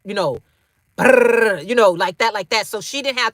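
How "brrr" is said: rolled brrr like rapid gunfire; quick rattling mouth gunfire; gunfire noise. Retold or imitated. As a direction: mimic an automatic weapon burst. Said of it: "the shots be like".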